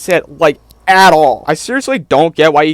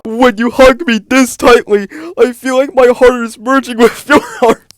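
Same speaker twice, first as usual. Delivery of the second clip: mock syrupy romance; 6 semitones higher than usual. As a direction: ham up a cheesy love line. Mocking Ethan's romantic line.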